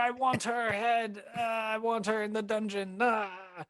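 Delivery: high, hammed-up wailing demands; wounded shouting. Injured mayor condemning his attacker, pained wailing. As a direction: cry out orders through acted pain. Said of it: "he's clutching his arm".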